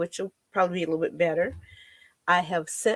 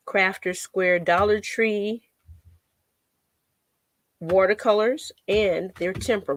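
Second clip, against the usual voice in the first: ordinary talk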